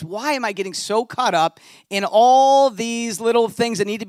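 self-rebuke swelling into joyful preaching; building preaching. Acted. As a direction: preach it with growing fervour. Rising sermon intensity.

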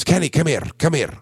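a Scottish sound guy beckoning in a high call; Scottish accent. Retold or imitated. Scottish engineer calls the drum tech to the desk, in a light Scottish accent. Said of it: "He's a Scotsman".

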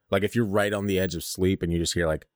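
usual speaking voice

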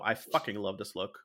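ordinary delivery

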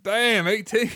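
high, loud exclamation of disbelief; mock astonishment. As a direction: blurt it out, hammed-up amazement. Mimed shocked reaction to a line.

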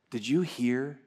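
ordinary talk

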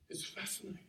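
soft, awed whisper. Real, not acted. Preacher marveling at the marks of the cross, hushed whisper. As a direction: breathe it as an aside. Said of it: "whispered repeat".

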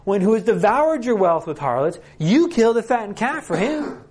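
furious, disbelieving protest to a father; outraged older son. Acted. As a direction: spit out the accusation, climbing in pitch. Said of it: "that's what he's doing here".

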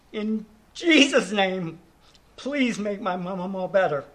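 put-on tiny child voice. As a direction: gently imitate a small granddaughter's simple prayer. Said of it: "in her tiny voice".

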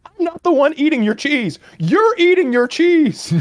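put-on high, loud, exasperated girlfriend. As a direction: girlfriend pushing the blame back. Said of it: "And she's like".

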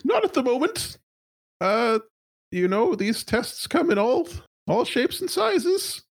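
high, nervous corporate stammer; a flustered high-pitched executive. Acted. GM holds executive voice.

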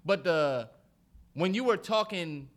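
normal talking voice

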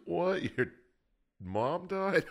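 mock disbelief. Acting out laughing at mourner.

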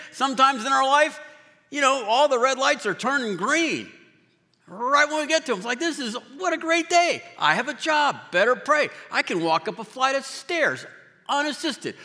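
high, bright upbeat voice; cheerful excitement. Acted. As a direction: gush about a great day. Acts out a cheerful good day.

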